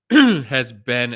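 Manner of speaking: words broken by throat clearing; coughing fit. Real, not acted. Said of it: "I'm sorry I'm coughing so much".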